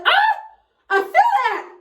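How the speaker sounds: very high, loud cry of pain. Retold or imitated. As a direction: yelp it as the needle goes in. Feeling stitches without numbing.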